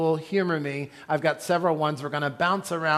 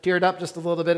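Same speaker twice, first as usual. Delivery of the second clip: ordinary talk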